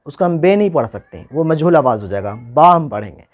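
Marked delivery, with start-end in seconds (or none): none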